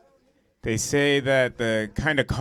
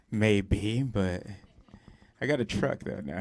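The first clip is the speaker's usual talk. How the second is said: ordinary talk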